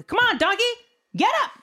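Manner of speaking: mock yell at donkey. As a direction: yell it like an annoyed rider, hammed up. Balaam yelling at his donkey.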